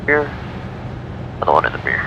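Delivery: ordinary talk, nothing marked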